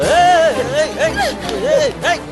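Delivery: very high sudden yells; alarmed yelling. Acted. Kidnapper yelling as the captive hits him and runs, high alarmed shouts. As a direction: shout after her in alarm.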